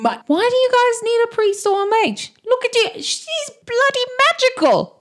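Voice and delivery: very high, excited outburst; amazed exclamation. Acted. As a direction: marvel aloud in a high voice. Character exclaiming in amazement at the magic.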